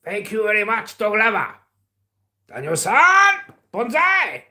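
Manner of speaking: high, emphatic mock Japanese voice; mock Japanese accent. Thanking a viewer in Japan, mock Japanese accent.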